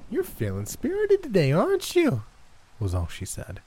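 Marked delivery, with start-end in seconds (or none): 0.1-2.2 high sing-song granny voice; syrupy creepy grandma; high sweet old woman. A narrator's reading. Gran character voice, creepy sweet.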